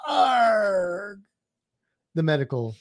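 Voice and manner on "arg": pirate shout. Pretend. Repeating pirate name.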